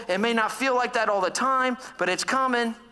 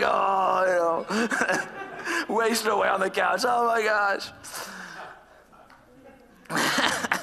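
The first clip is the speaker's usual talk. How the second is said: laughing at himself. Real, not acted. Mocking himself wasting on couch.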